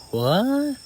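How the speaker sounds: high, loud disbelieving question; shocked. A narrator's reading. Girl walking into hidden tech room, shocked. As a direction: let the word shoot upward in surprise. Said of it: "a bit shocked and say".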